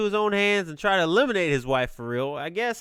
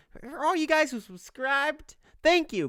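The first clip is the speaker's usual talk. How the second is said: mock influencer gushing, much higher and sweeter; Instagram model voice; 10 semitones higher than usual. Vlogger thanking subscribers in a put-on Instagram model voice. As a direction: purr a thank-you like an influencer to followers. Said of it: "I sound like an Instagram model now".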